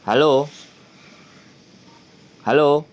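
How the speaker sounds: loud repeated hello; calling out on phone. Real, not acted. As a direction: raise voice over a bad line. Calling over bad line.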